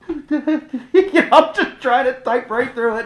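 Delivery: laughing high and loud through the words. Real, not acted. Pastor laughing about typing through chest pain. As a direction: chuckle at his own stubbornness.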